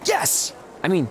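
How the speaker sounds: sudden high-pitched cry of joy; excited yes. Acted. Champion hearing of a new contract, excited outburst. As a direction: let an elated yes burst out, very high. Overjoyed at getting the Raw contract.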